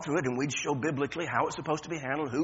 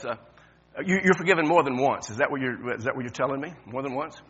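ordinary delivery

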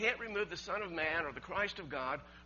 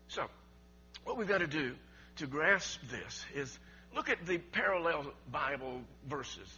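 ordinary talk

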